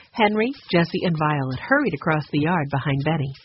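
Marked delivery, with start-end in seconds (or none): none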